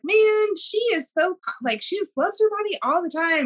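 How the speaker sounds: high-pitched, awed gushing; gushing admiration. Retold or imitated. Host retelling her reaction to guest's page, gushing. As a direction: re-enact being wowed, pitch jumping up.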